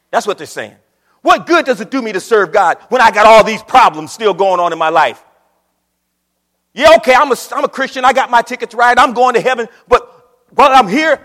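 loud, driving sermon voice; preaching at full force. Acted. Preacher voicing worldly Christians, loud and driving. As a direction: hammer the point home to the pews.

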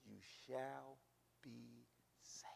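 soft, unvoiced promise; hushed promise of salvation; whispered promise. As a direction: breathe it out softly.

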